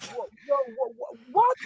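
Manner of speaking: very high stammered mock shock; retold stunned disbelief. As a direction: splutter in a sky-high voice, re-enacting shock. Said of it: "And I'm like".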